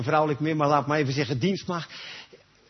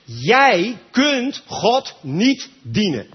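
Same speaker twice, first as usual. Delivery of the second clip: each word slow and louder, weight on jij; slow emphatic declaration; 4 semitones higher, 5 dB louder, much slower than usual. Acted. Preacher telling the congregation they cannot serve God, slow and heavy. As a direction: hammer the point home word by word.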